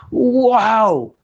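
high, slow rejoicing; exultant storyteller; joyful excitement. A narrator's reading. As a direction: lift them up with his joy. Joy at carrying the matzot.

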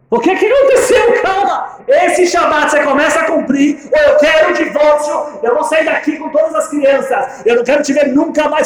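high, loud shouting ultimatum; furious ultimatum. Retold or imitated. Wife threatens divorce, shouting high.

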